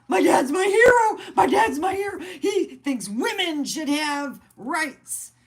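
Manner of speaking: proud daughter gushing over her dad; childlike cheer. Retold or imitated. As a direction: squeal it like a proud kid.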